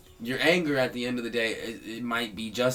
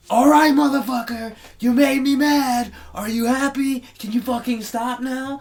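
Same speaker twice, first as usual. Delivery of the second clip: high, loud mock yelling; angry outburst; 13 semitones higher, 7 dB louder than usual. Fed-up person yelling at a provoker who made them mad, angry. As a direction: re-enact blowing up at someone, voice pitched way up.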